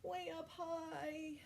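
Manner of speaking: strained voice mid-stretch. Shown as a demonstration. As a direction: talk while stretching up. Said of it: "Can you do that?".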